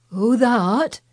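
lightly shaky, bewildered question; queer shaky voice. A narrator's reading. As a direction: answer unsteadily in Yorkshire dialect. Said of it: "answer in a queer, shaky voice".

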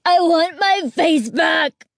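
high, slow, loud shriek. A narrator's reading. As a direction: shriek it at him in rage. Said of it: "she shrieked".

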